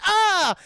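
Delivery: high, loud sharp cry of pain; mock pained yelp. Peter getting bitten by the spider, sudden loud yelp. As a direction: yelp like a spider just bit you. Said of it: "He goes".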